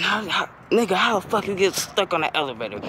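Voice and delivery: high, taunting put-on girl voice; mocking friend. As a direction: tease him for getting stuck.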